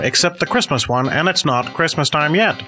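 high, bright 1950s radio host voice; old-timey radio announcer. Acted. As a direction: announce it like a vintage radio serial. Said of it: "this old-timey radio guy".

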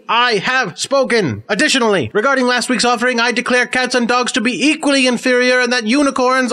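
grand all-caps decree, pitched high; vigorous booming read. Acted. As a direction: declaim it like a self-important cosmic judge. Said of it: "it's written in all capital letters, so I feel I should say it kind of, uh, vigorously".

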